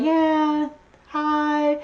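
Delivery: drawn-out, half-hearted hello; unenthused greeting. Retold or imitated. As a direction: imitate greeting people while not feeling it. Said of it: "I just was not feeling it".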